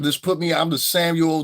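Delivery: ordinary talk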